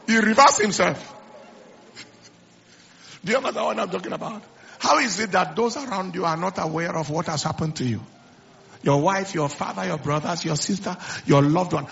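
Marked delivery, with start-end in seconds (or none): none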